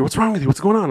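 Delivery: high, urgent questioning; retold worried urging; re-enacted alarm. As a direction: shake them out of a daze. Trying to shake girlfriend, retold.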